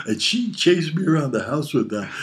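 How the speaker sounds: ordinary talk, nothing marked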